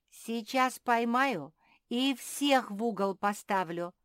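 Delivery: loud, high, deliberate warning; exasperated mother; scolding threat. Acted. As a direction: threaten the naughty children slowly and firmly. Mother threatens her disobedient children.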